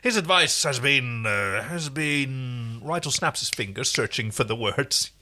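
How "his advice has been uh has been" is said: pompous alien royal. Acted. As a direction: play the vain little alien king. Rigel's haughty voice, held.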